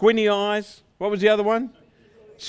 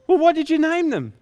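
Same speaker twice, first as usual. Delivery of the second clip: high, louder, eager family voice; eager question; 9 semitones higher, 5 dB louder than usual. Acted. Family to shepherd about new sheep, high and eager. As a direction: jump in with raised curiosity.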